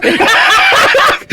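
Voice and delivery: high-pitched helpless giggle; high giggle burst. Real, not acted. Host cracks up mid-sentence.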